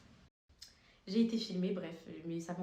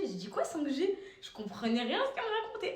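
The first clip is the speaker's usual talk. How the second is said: usual speaking voice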